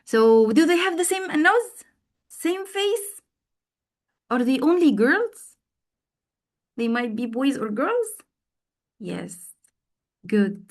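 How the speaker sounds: high, bright questioning teacher voice; teacher asking check questions. Shown as a demonstration. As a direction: ask concept checks like to a class. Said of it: "I C C Q".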